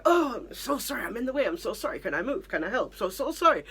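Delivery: mock chorus of polite Brits apologising; effusively apologetic. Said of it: "would also be apologising".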